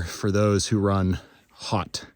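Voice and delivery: normal talking voice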